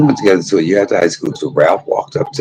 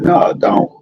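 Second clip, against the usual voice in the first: high, mock-tearful plea with little voice; imitated tearful pleading, half whispered; retold crying plea; 12 semitones higher than usual. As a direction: act out begging through tears. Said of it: "I'm crying, like".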